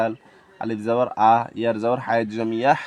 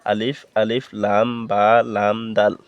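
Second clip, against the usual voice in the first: ordinary delivery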